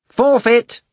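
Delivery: high, loud cat bellow. A narrator's reading. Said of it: "bellowed the cat".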